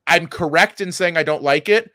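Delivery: usual speaking voice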